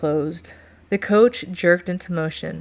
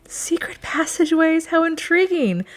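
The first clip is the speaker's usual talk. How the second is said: much higher, airy excitement; intrigued delight; 11 semitones higher than usual. A narrator's reading. Woman hears of the Duke's secret passageways, excited and intrigued. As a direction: gasp it out high, thrilled and intrigued.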